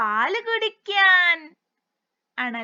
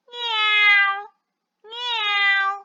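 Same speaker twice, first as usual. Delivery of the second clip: drawn-out cat meow; imitated cat's cry; 3 semitones higher, 4 dB louder, much slower than usual. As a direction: meow like a kitten, twice. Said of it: "എങ്ങനെയാ കരയുന്നത്?".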